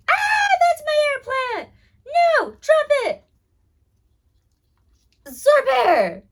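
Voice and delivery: high panicked yell at the dog; alarmed shout. Real, not acted. Dog grabs her air plant.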